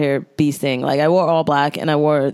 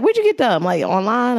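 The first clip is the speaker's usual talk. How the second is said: quick New York style question; 5 semitones higher than usual. Retold or imitated. Lady asking where the shoes came from, in a light New York accent. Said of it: "that was her accent".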